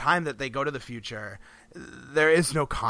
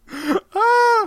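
high, loud mock cry of fright; hammed-up little shriek; mock scream; 11 dB louder than usual. Joking to co-host about a Roanoke-like disappearance, mock scream. Mimes the vanished colony's fright.

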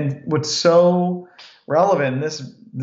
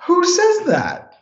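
very high, slow, incredulous "who says that"; 4 dB louder, slower than usual. Retold or imitated. Wife reacting to his self-praise, incredulous and very high. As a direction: stretch it out in total disbelief. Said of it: "She's like".

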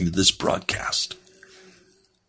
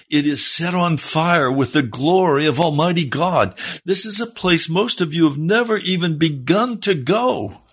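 higher, urgent preacher's call; fervent preaching; 9 semitones higher than usual. Acted. Preacher urging listeners toward the Lord, fervent and raised. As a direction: lift it like a revival sermon.

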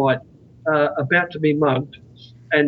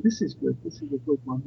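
low, soft self-talk; softly savouring it; contented musing to himself; 4 semitones lower, 10 dB quieter than usual. Retold or imitated. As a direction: drop to a quiet, satisfied aside. Voicing contented thoughts over beer.

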